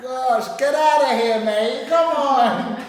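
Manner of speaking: put-on loud teasing London accent; imitating a London accent to tease relatives; mock London accent. Mocking relatives who tease his London accent, loud London accent. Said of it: "how come I speak with that London accent".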